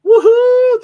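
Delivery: loud high whoop; excited cheer. Real, not acted. Cheering the thumbs up.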